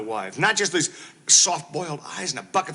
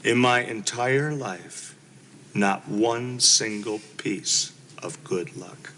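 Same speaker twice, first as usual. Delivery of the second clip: each word spelled out slowly and lower; slow, pointed repeat; 10 semitones lower, much slower than usual. Acted. Husband repeating his complaint to his wife, slow and lower. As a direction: drag it out to make the point. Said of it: "I repeat".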